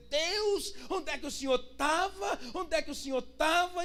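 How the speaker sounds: high-pitched questioning of God; aggrieved, high-pitched protest; indignant complaint. Acted. Acting people's complaint to God.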